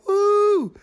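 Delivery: short high-pitched whoop; high woo. Real, not acted. Vlogger doing eyeliner, high whoop.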